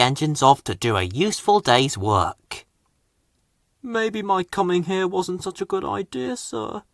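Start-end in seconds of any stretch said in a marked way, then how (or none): 3.8-6.8 softer, higher dejected voice; sad Harvey. A narrator's reading. Crane engine sadly telling his boss, soft and high. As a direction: say it softly, feeling unwanted. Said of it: "Harvey chuffed sadly".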